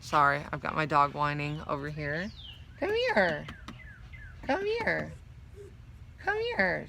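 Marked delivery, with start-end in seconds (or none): 2.8-6.9 much higher sing-song coaxing to a pet; calling the dog. Real, not acted. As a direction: coax the dog over sweetly, soft and high. Coaxing her whining dog Bella.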